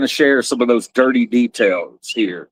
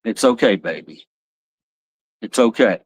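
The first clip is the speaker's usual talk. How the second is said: soothing; 4 semitones lower than usual. Real, not acted. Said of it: "I've gotta comfort her".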